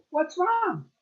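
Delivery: surprised Senior Snake voice; alarmed old snake. Acted. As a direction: call out to the returning snakes in alarm. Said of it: "he said".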